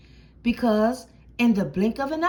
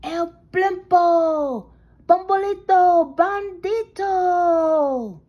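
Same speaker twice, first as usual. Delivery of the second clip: slow, loud, high cry; dramatic cry; 6 semitones higher, 7 dB louder, slower than usual. Acted. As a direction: cry out each name slowly. Crowd names the villain.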